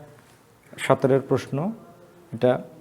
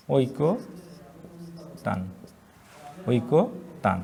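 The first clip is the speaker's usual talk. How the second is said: ঐকতান drawn out slowly and softer; dictating ঐকতান quietly and slowly; slow dictation; 7 dB quieter, much slower than usual. Shown as a demonstration. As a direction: stretch ঐকতান softly. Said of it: "dictating word slowly".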